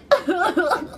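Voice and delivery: high, hammed-up fake sob; mock sobbing. Host mimics Josh in the bathroom, mock sobbing. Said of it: "he is freaking sobbing".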